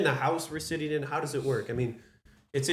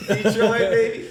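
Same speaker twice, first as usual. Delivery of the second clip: put-on laughing, loud copy of a Detroit accent; mock Michigan accent; 8 semitones higher, 7 dB louder, slower than usual. Host echoing guest's Detroit, mock accent. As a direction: mimic his Michigan accent, high and loud. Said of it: "Ask him to say Detroit".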